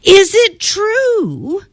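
high, sweet-talking princess voice; girl buttering up a vain dragon; flattering princess. Acted. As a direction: coax the dragon with wide-eyed praise.

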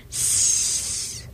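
soft unvoiced hiss; snake hiss. A narrator's reading. The snake on the rock, soft hiss. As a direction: hiss through a forked tongue. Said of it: "she hissed".

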